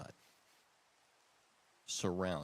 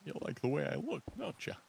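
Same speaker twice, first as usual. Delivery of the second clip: Walken-ish voice; 5 semitones higher, 6 dB louder, faster than usual. Pretend. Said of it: "Christopher Walken in there".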